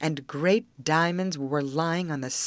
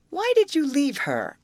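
snowman voice; 10 semitones higher, 5 dB louder than usual. A narrator's reading. Snowman asks longingly.

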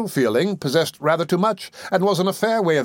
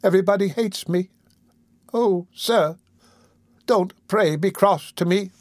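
put-on high, pitiful little-boy plea; acted tearful pleading, haltingly; crying child; 6 semitones higher than usual. A narrator's reading. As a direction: implore tearfully in a small raised voice. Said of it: "cried the child".

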